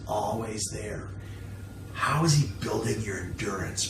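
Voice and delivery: usual speaking voice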